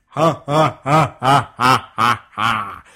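loud, evenly punched villain laugh; booming giant laugh. Acted. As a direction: boom out each "ha" separately. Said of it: "and you go".

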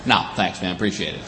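low, soft brush-off; subdued, low-pitched thanks; muted polite refusal. Acted. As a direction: decline quietly under your breath. Declining unwanted advice.

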